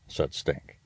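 usual speaking voice

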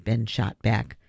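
usual speaking voice

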